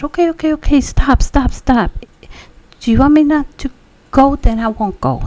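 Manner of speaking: high, loud pleading to make him stop; retold frantic okay stop, loud and high. Begging angry husband to stop.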